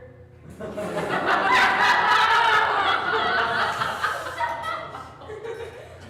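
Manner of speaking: long, loud burst of high laughter; helpless laughter. Real, not acted. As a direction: crack up completely and keep laughing. Breaks up during guessing game.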